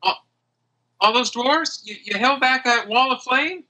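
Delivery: high, questioning character voice; puzzled Aggie. Acted. Friend reminding the hero of the rescue, puzzled.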